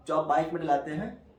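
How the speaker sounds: normal talking voice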